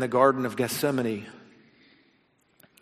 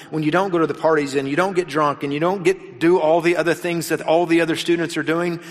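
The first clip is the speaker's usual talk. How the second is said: ordinary talk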